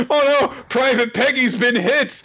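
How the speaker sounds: very high cartoon panic; hammed-up alarm. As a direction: cry out in mock horror. Acts out dramatic cartoon battle scene.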